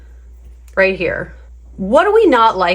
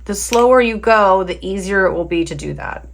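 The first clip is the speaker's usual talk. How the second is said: normal talking voice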